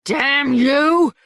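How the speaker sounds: high loud cursing shout; furious yell. A narrator's reading. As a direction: curse them as they fly. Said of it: "Skebel yelled".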